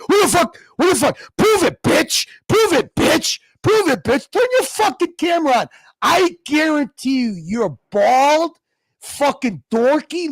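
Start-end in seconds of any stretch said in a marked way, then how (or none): 0.1-5.7 taunting. Real, not acted. Taunting anonymous chatter.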